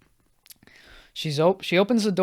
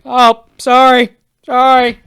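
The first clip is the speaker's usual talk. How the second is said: loud, high, drawn-out sorry; retold startled apology; 9 semitones higher, 12 dB louder, slower than usual. Man stumbling into a room of women, loud flustered apology. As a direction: blurt it out as if walking in on someone.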